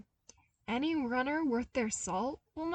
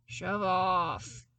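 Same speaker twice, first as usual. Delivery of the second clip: playful grumble; 4 dB louder than usual. Acted. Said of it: "Zev grumbled".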